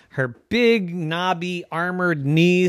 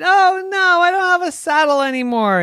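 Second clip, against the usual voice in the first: much higher and louder, distraught; dismayed wail; 6 dB louder than usual. Acted. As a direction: cry out over the missing saddle. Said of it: "And she said".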